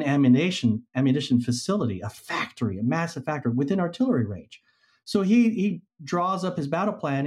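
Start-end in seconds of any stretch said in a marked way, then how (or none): none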